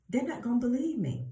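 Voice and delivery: high-pitched mock objection; protesting excuse. Moses's excuse to God, acted.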